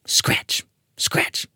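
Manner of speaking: unvoiced scratch sound, hushed; soft, eerie scratch effect; whispered scratching. A narrator's reading. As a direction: whisper it like claws on wood. Narrator voicing scratching noise.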